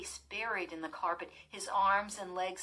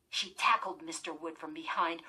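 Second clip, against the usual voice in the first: usual speaking voice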